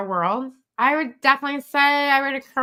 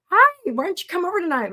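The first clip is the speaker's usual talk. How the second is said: a higher, brighter voiced friend calling you over; cheery friend invite; 9 semitones higher, 4 dB louder than usual. Mimicking a friend on Facebook, higher and louder invite. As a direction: sing out the invitation like a chummy pal.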